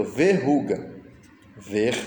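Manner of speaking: verruga said whole, then slowly split ver, ru, ga; syllable by syllable. Shown as a demonstration. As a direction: separate verruga with long pauses between syllables. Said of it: "Na separação de sílaba".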